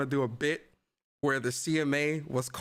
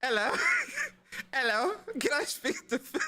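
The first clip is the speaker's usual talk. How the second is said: a lightly put-on UK accent, higher; mock UK accent; 10 semitones higher than usual. Streamer prank-calls Phil as the CMA in a lightly put-on UK accent. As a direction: lightly play a British caller, holding back laughter. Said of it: "get into character".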